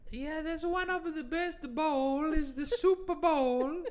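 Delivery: a hammed-up Italian Super Bowl expert, Italian accent; radio sketch Italian expert, slow Italian accent. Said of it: "I did my Italian voice".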